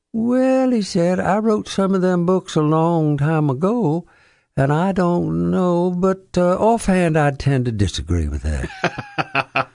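put-on a sly old Southerner shrugging off a question; imitating a dry Southern author with a chuckle; Faulkner impression. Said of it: "Mister Faulkner".

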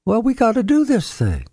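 put-on an old Southern writer insisting on what's right; imitating a Southern author's firm pronouncement; Faulkner impression. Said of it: "And he said".

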